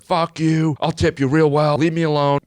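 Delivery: high-pitched impression of a woman yelling; imitated yelling. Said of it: "yelling at the cab driver".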